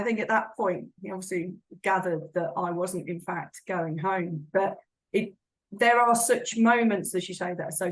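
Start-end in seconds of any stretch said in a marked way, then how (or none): none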